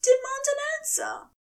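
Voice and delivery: high, quick angel demand; angry angel. Acted. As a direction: demand it with full name, high and sharp.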